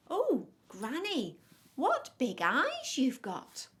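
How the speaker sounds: slow, high, loud wonder; drawn-out storybook astonishment; amazed little girl. A narrator's reading. Girl marvels at false Granny.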